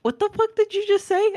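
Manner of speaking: high-pitched mock outrage; incredulous relatives. Relatives hear her say it differently, mock shock. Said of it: "they're like".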